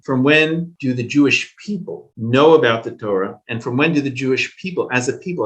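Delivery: usual speaking voice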